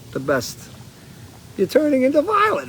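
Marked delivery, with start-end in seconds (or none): 1.6-2.7 much higher squeal of alarm; mock alarmed. Quoting the blueberry scene as the girl swells, mock alarm. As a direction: yelp it like a shocked parent.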